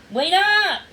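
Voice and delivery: loud, high call. A narrator's reading. Boy calling after the darting puppy, loud.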